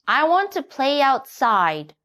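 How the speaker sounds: put-on child donkey wheedling; Little Donkey. A narrator's reading. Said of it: "he said".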